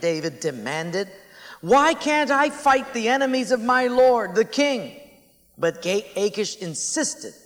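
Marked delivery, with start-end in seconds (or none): 1.6-5.0 higher, louder protest; indignant demand. Acted. David protesting to King Achish, angry and louder. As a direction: demand it like you've been wronged.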